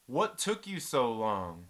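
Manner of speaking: slow, drawn-out mock question; drawn-out taunt. Taunting the telemarketer who finally hung up, drawn out. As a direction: stretch it out at an even volume.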